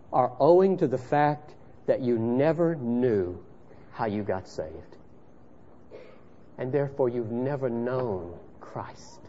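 usual speaking voice

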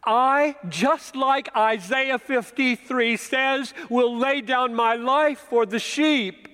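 fervent pulpit proclamation; preaching. Acted. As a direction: preach it out with conviction. Preaching at full force.

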